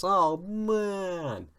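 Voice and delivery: very high, stretched-out wail; exaggerated dismay. Real, not acted.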